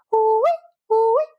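toned-down imitation of a village alarm cry; softened alarm call. Shown as a demonstration. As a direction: demo the village alarm cry without full volume. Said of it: "except, like, way, way louder".

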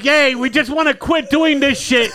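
high, loud sarcastic yay; sarcastic cheer. Retold or imitated. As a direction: cheer with heavy irony. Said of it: "me and Danny are like".

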